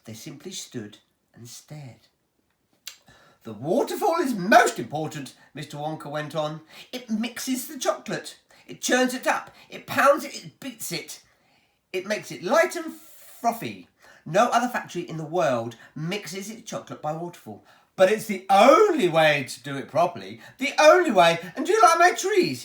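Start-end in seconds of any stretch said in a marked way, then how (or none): 3.4-5.3 high, loud showman boasting; a proud host showing off his pipes; Willy Wonka voice. Acted. Wonka booms to the children about his pipes, showman voice. 6.9-22.6 high, loud showman boasting; a proud host showing off his pipes; Willy Wonka voice. Acted. Wonka booms to the children about his pipes, showman voice.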